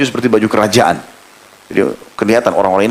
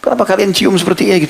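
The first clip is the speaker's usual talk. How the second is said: puzzled question; 8 semitones higher than usual. Retold or imitated. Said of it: "tambah heran".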